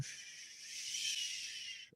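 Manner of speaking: soft unvoiced shush to quiet a crowd. Real, not acted. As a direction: hush the room with a long shh. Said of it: "Let's bring a hush over the room".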